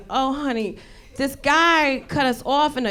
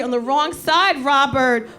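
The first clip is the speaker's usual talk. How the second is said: ordinary delivery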